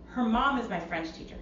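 usual speaking voice

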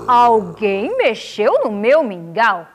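papa bear's grave voice; deep-voiced papa bear. A narrator's reading. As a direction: speak slowly as the big gruff-voiced father bear. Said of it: "falou com a sua voz grave".

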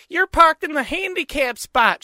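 high-pitched mocking complaint; mocking her voice. Man mimics woman scolding him over parking, mocking high voice. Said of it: "She sounded just like that fucking dude".

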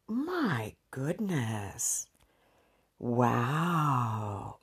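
soft, very slow, drawn-out awe; stretched-out, softly amazed sigh of words; awed swoon. Real, not acted. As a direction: savour the memory, hushed and lingering. Swooning over the date she recalls.

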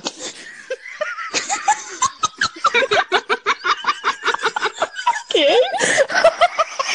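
helpless high laughing fit; long high laugh. Real, not acted.

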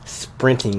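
"sprinting" with an emphasized T, drawn out; crisp stressed T. Real, not acted. Podcast guest telling his co-host he'd flee, "sprinting" with emphatic T. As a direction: hit the T hard in "sprinting", taking it slowly. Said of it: "Emphasis on the T".

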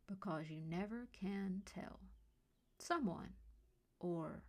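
slow, ominous suspense delivery; eerie sign-off. A narrator's reading. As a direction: let each phrase hang, trailing into near-whisper. Eerie sign-off of a story podcast.

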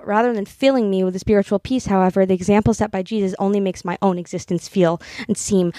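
earnest literary reading, lightly performed; reading a novel aloud. Acted. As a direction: read the passage like a heartfelt confession. Reads a novel passage aloud.

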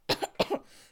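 short real cough; one painful cough; coughing fit. Real, not acted. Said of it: "it really hurts".

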